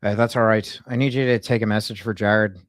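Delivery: put-on Irish twang; Irish accent. Prank caller leaving a message with a staffer, in an Irish accent. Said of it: "she really liked my Irish accent".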